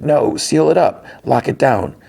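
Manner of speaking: high-pitched retold lockdown orders; mock yell. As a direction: imitate a commander yelling orders without real volume. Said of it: "and he yells".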